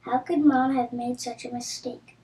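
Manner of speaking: ordinary delivery, nothing marked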